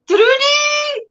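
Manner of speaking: high sing-out greeting; calling out. Real, not acted. Streamer hails her friend Trudy, calling out high. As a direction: call the name high and drawn out.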